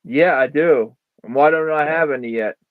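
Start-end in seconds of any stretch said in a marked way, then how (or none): none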